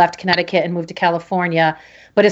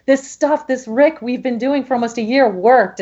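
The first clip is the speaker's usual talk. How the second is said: tearful insistence; 6 semitones higher than usual. Retold or imitated. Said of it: "kept trying to say to my mom".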